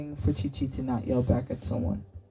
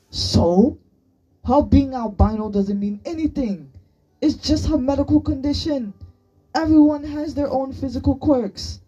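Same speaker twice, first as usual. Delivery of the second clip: loud, high, indignant retort; heated rebuttal; 11 semitones higher, 7 dB louder than usual. A narrator's reading. Boy dismissing his friend's argument about Sunny, heatedly. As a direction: argue back at full voice. Arguing back in quarrel.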